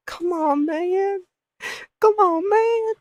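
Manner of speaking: very high, squeaky mock whine; comically squeaked complaint; whiny pleading. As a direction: plead in a tiny high falsetto. Kid pleading with tattling phone.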